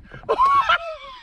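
high shrieking laugh; shocked laugh. Real, not acted. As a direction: burst into a disbelieving laugh. Catch surprise.

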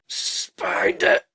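high, slow, breathless whisper; whispered croak. Acted. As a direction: strain out the word barely able to speak. Paralysed man gasps a word.

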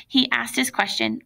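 ordinary delivery

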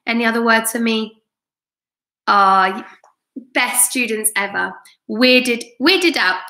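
ordinary delivery, nothing marked

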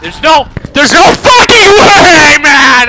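loud screamed disbelief; enraged scream. Real, not acted. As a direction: explode in real rage at the game. Raging at a death.

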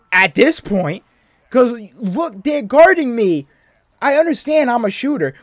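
loud, exasperated complaint; frustrated rant. Real, not acted. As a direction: rant at the unfair game. Ranting about tight defense.

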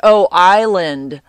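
loud, drawn-out exclamation of recognition; sudden realization. Real, not acted. Podcaster suddenly remembering a friend's word, sudden realization. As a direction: it dawns on you, blurt it out loud and slow. Recalls what she misheard, exclaims.

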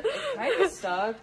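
laughing through the words. Real, not acted. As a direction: giggle it out, high and slow. Noodle test on the cabinet.

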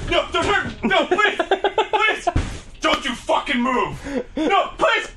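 high panicked begging; mock pleading. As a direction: beg for mercy, hammed up. Pleading victim bit.

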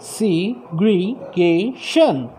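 segregation drawn out very slowly as seg-re-ga-tion; syllable by syllable. Shown as a demonstration. Teacher splitting segregation into syllables, very slowly. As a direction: stretch segregation into its parts. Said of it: "ऐसे ही segregation".

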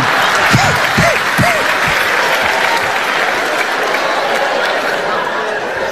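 high breathy ghostly laugh; spooky high laugh. Acted. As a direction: cackle like a spirit. Spooky laugh after beckoning.

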